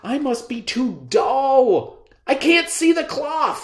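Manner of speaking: worried emperor panicking inside; panicked thought. Acted. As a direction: emperor fearing he looks foolish. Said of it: "he thought to himself".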